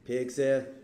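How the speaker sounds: ordinary delivery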